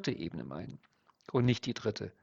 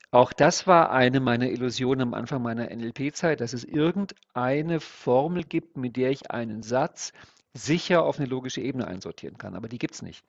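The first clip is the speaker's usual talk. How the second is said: ordinary talk, nothing marked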